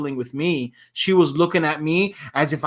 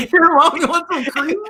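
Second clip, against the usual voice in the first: much higher, teasing mock offer; mock kid voice; 11 semitones higher, 4 dB louder than usual. Listener jokes as the kid offering mom cream, high mock tease. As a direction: play the silly spa kid squirting cream. Joking about squirting face cream.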